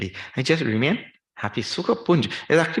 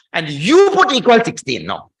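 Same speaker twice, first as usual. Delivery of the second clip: raised angry voice; loud angry order to the painter; angry shout; 6 semitones higher, 10 dB louder than usual. Retold or imitated. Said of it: "Again, he got angry".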